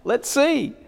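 high, bright, expectant voicing of the friends; eager onlookers. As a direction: peer down eagerly, waiting to see. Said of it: "they're saying".

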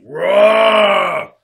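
loud, sustained Bigfoot bellow; Sasquatch roar. Acted. Sasquatch walking in on the campers, roaring. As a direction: roar it out like a big beast.

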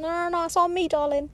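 high, hammed-up charming insistence; gallant drunk guy. Tipsy guy insists on paying, hammed-up charm. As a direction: play the smooth generous bloke. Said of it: "he's like".

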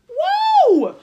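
very high loud whoa; hammed-up high whoa; mock outraged whoa. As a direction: shriek it in mock shock. Brothers' outrage, retold.

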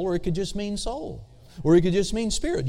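ordinary talk, nothing marked

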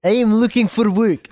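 lightly voiced thick Russian accent, a bit fast; mock the Russian wife, Russian accent; a hint of a heavy Russian accent. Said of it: "the thickest Russian accent I've ever heard".

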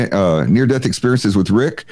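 ordinary talk, nothing marked

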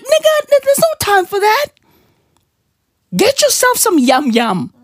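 high-pitched mock comeback to a friend; high exasperated retort. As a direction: brush him off in a squeaky put-on voice. Retorting to complaining friend in quote.

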